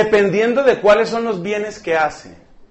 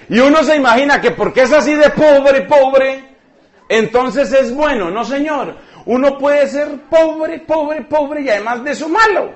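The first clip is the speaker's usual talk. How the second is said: emphatic and amused; 6 semitones higher, 4 dB louder than usual. Acted. Preacher emphasizes, laughing.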